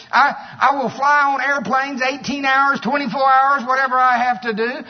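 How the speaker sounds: loud, high declaration of faith; fiery preaching. Acted. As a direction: preach it out with fire. Preaching at full force.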